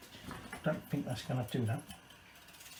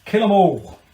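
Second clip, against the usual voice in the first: loud, high-pitched kingly order; acted royal command, hollered out; 7 semitones higher, 11 dB louder than usual. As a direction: shout the order like a storybook king. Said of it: "So he said".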